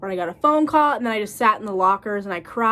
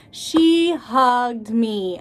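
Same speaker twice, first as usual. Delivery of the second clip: HUGGED drawn out slowly and pushed; emphatic repeat; 3 semitones higher, 5 dB louder, much slower than usual. Real, not acted. Stylist on client's reaction, slow emphasis on "hugged". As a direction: stretch "hugged me" in happy disbelief.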